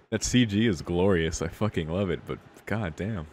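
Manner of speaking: usual speaking voice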